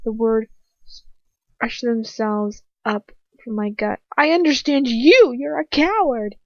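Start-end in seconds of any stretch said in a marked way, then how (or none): 4.2-6.3 loud, higher, out of control; furious yell. A narrator's reading. Girl yells coward at her captor, out of control. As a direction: hurl it at him. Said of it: "unable to control myself".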